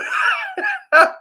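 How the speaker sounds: a high, squealing burst of real laughter. Real, not acted. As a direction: crack up high and helplessly. Laughs at the story.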